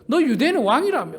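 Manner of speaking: mock him as a fake king; mocking taunt. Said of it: "조롱하는 거예요".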